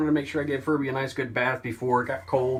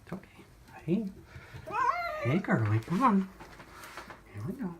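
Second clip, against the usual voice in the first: soothing a scared cat; 11 semitones higher, 6 dB quieter than usual. Real, not acted. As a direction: coax a frightened cat gently. Calming cat in the bath.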